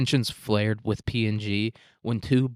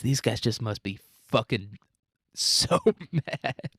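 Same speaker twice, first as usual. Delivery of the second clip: usual speaking voice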